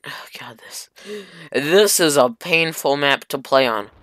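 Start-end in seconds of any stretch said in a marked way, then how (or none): 0.1-0.9 whisper. Real, not acted. Defeated sigh.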